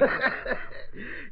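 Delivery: quiet breathy chuckle; amused quiet laugh at the constable; soft laugh. Acted. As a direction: laugh it off lightly. Sergeant amused by the question.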